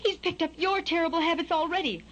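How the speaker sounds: high, accusing mother bird; high-voiced bird mom scolding; aghast mother bird. A narrator's reading. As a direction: complain in a high, dismayed voice. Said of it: "the mother bird gasped".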